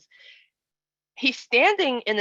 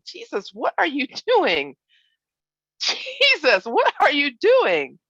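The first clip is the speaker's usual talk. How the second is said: high, louder, building alarm; alarmed mother; 7 semitones higher, 4 dB louder than usual. Acted. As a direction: cry out to the lost son. Mary finding lost son.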